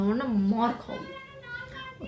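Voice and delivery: very high, slow, drawn-out playful warning; mock threat. Telling viewers to subscribe, high sing-song mock threat.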